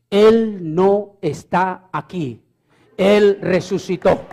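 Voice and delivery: Él no está aquí read very slow and weighty, stress on Él; solemn slow proclamation. Shown as a demonstration. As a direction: proclaim each word of the sign slowly. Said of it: "el letrero dice".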